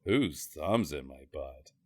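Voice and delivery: low and slow, dragging each word; deep, slow mock voice. Second voice in the crammed car answering, low and slow. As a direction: drop the voice way down and drag it out. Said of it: "But also".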